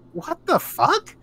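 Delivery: high, slow, incredulous; stunned what the fuck. Retold or imitated. Reacting to a political ad, high slow disbelief. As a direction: drag it out in disbelief. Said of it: "And I'm like".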